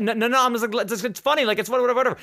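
high, nervous laughing-off; nervous backpedal. Retold or imitated. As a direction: try to smooth it over fast. Nervously downplays it.

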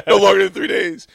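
loud, high, laughing echo; real amused laughing. Real, not acted. Dad echoing his daughter's three-day rule, laughing. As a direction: repeat it back through a laugh.

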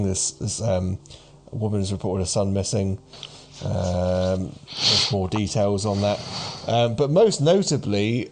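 normal talking voice

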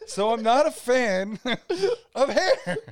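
laughing loudly while saying it. Real, not acted. Cleaner laughs at host's joke, talking through loud laughter.

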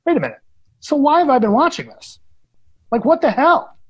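mock outrage at the twist; exasperated disbelief. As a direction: play your own baffled reaction. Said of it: "I'm like".

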